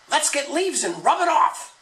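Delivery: high, urgent woman's voice; wife urging a quick cover-up; eager Eve. Acted. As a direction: pitch it up, eager to fix things. Even proposes hiding the blood.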